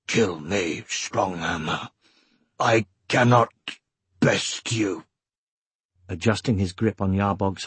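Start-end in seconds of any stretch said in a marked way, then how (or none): 0.1-5.1 low, slow Urgel voice; defeated Urgel, rasping and halting; muttered undertone. A narrator's reading. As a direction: mutter it in a rough creature's undertone. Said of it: "in an undertone he muttered".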